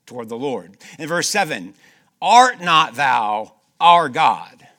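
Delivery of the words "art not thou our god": loud, ringing scripture reading; preaching at full force. Acted. Preacher reads a king's prayer aloud, loud and ringing. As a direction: proclaim the king's prayer to the congregation.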